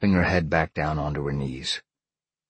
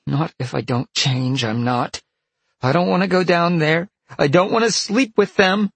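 put-on high girl's voice building louder; refuse, getting higher and louder; protesting girl; 13 semitones higher, 6 dB louder than usual. A narrator's reading. Peg refuses to go down.

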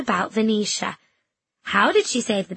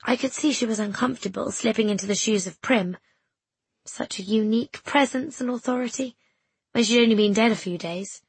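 ordinary delivery

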